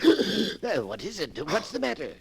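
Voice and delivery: alarmed question. Acted. Stranger notices man's reaction.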